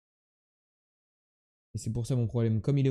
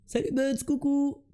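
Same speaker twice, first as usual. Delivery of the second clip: sing-song hello; 11 semitones higher, slower than usual. Real, not acted.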